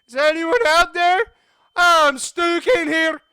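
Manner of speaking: high, very loud acted yell; calling for help. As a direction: shout through closed doors to be heard. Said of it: "I called out".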